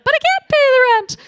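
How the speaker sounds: high, fast, pleading damsel; damsel in distress. Retold or imitated. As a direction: wail it like a helpless heroine. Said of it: "you're in a cartoon with this bag".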